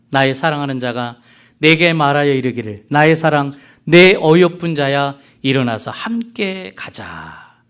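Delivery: solemn, drawn-out Bible reading, swelling midway; declaimed love verse from scripture; scripture recitation. Acted. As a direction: recite the verse slowly, stretching the final words. Reads scripture to congregation.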